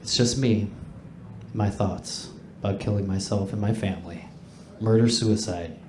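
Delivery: usual speaking voice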